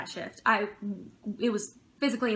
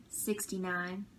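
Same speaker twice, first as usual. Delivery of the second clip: creepy joke; 4 semitones lower, 10 dB quieter, slower than usual. Retold or imitated. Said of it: "made a sixty-nine joke".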